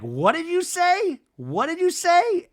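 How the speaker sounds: high, slow indignant imitation; mock Danny outburst. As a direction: pitch it way up, slow and offended. Mimicking drunk Danny's outburst.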